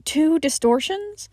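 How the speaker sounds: plain reading voice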